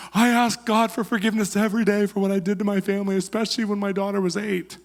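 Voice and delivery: a touch of sobbing through the words; weeping confession. Retold or imitated.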